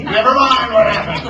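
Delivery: loud, high angry pirate roar. A narrator's reading. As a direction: roar to shut down the question. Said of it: "roared Hook".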